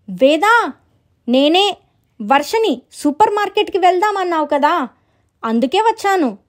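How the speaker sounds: high, lilting call; visitor at the door. Acted. Visitor calls from outside.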